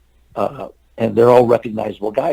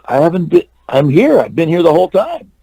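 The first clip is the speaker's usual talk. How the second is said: higher-pitched retold protest; mildly indignant defence, voice raised in pitch; 6 semitones higher, 3 dB louder than usual. Actor answering casting director's scolding, protesting.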